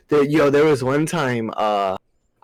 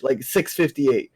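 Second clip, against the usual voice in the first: ordinary talk, nothing marked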